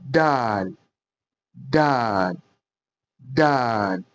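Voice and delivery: "dal" heavy and dark from the back of the mouth; thick dal. Shown as a demonstration. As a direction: make "dal" thick and full. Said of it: "This one is going to be very thick".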